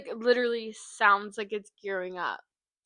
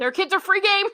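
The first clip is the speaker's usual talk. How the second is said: high, very loud exclamation; excited outburst; 10 semitones higher, 12 dB louder than usual. Real, not acted. Co-host cheering on the kids marrying, excited outburst.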